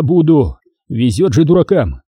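ordinary delivery

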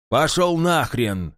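shouted slow insult. A narrator's reading. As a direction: spit out a yell. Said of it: "выкрикнул новый голос".

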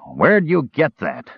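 put-on hoarse drunk confronting someone; hoarse demand. A narrator's reading. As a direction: demand it hoarsely, dragging the words. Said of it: "he demanded hoarsely".

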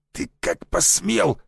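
slow, strained angry hiss. A narrator's reading. Mercenary grabbed by the Adam's apple, hissing. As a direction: hiss it out slowly through the throat. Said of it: "зашипел он".

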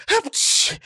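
high, unvoiced "achoo"; short stage sneeze; acted sneeze. A narrator's reading. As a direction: fake a quick sneeze. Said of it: "чихнув, сказал Ниса Чих".

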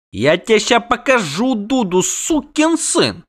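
high, strained, drawn-out threat; angry bellow. A narrator's reading. Halfling threatens the doppler with fist, bellowing. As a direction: roar the threat at a high pitch. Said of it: "рявкнул Дайенти".

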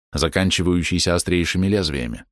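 ordinary delivery, nothing marked

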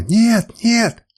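high, loud repeated protest; screamed refusal. A narrator's reading. Woman refuses to be given to slave, screamed protest. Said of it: "закричала она".

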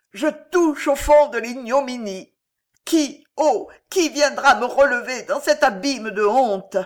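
high, loud, tragic lament; gasped despair. A narrator's reading. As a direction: cry out from the depths of shame. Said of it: "tomba à genoux et haleta". (lamentation désespérée, criée)

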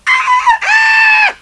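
high shrill yelp of shock; a kobold's startled scream; alarmed cry. Acted. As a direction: shriek as if something went badly wrong. Pumuckl cries out in alarm.

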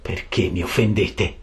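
low, slow, understated indignation; restrained anger. A narrator's reading. Geppetto confronts the carpenter over an insult, low slow anger. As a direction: seethe slowly, keeping it down. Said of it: "gli disse imbestialito".

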